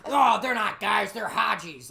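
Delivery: loud, slow mocking voice; mock the eager recruits. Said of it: "mocking recruits".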